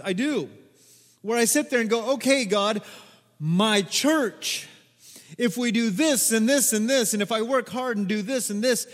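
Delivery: loud, high, driving preacher's cry; preaching at full force. Acted. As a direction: preach it out to the whole church.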